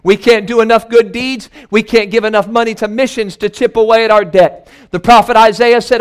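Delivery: ordinary delivery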